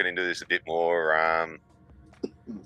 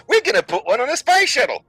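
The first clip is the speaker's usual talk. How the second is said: very high, louder gushing announcement; mock children's presenter; 5 dB louder than usual. Presenter telling school kids about the shuttle, mock-cheery squeal. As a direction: squeal the big news in mock delight.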